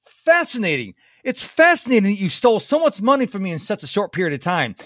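high-pitched mock amazement; humorous fascination. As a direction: retell it as amused fascination at being robbed. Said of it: "he decided to use humor".

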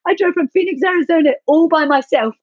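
high, tearful voice full of pride; overcome, crying through the words; real crying. Real, not acted. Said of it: "now that just made me cry".

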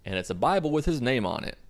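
ordinary talk, nothing marked

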